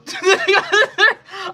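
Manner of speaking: big high laugh, cracking up; loud burst of laughter. Real, not acted. Laughs at her own fumble.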